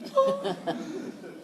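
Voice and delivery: high gasp of alarm, half laughing; sharp laughing gasp. Retold or imitated. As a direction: catch your breath as it hits you. Realizing the jacket left behind.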